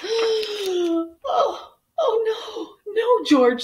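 very high mock-distressed oh no; wailing mother. Mother dog hearing George oink, high mock wail. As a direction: wail in exaggerated despair.

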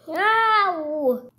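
high, drawn-out pretend ow; pained yelp. Character gets hurt in a play fight, high pained yelp. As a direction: cry out in mock pain.

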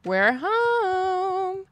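high, sung-out homecoming call; chirpy high "we're home"; singsong announcement. Real, not acted. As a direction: sing it out like you just walked in the door. Announcing arrival in game.

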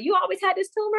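mimicked casual doctor in a high voice; nonchalant. Doctor casually asking patient about a tumor, nonchalant. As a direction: toss off the question high and breezily. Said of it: "like, nonchalant about it".

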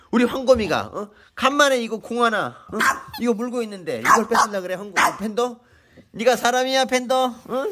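real exasperated reprimand to a bullying pet; indignant scolding. Real, not acted. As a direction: scold the dog like a wronged parent.

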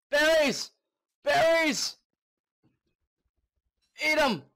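high, loud excited yelling; excited shout. Real, not acted. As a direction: shout with sudden joy. Spots berries while starving.

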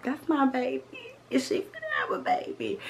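high, whispered disbelief, mother voice; imitating mom. Daughter retelling mom's reaction to baby news, high whisper. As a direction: squeal it breathless like a thrilled mom. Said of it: "she was like".

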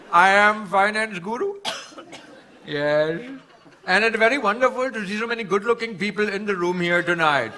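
put-on comic Indian-accented stand-up guru.

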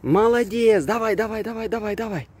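high, bright praise for the dog; talking to a dog. Real, not acted. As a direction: cheer the dog on, pitch up. Praising dog during fetch.

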